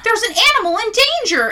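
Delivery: high, loud heroic alarm call; cartoon rescue hero. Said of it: "You're like Paw Patrol".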